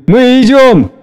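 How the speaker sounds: high, drawn-out answer; calling back. A narrator's reading. Lyubov answering the young people outside, calling back. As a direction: call back to those waiting.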